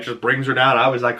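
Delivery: ordinary talk, nothing marked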